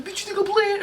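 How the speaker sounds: very high-pitched mock robber; hammed-up high robber voice; high mock threat. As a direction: threaten in a silly, sky-high voice. Acted robber exchange about password.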